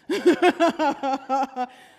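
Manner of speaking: big burst of high laughter; loud laugh. Real, not acted. Preacher cracking up at Jonah's refusal, loud laugh.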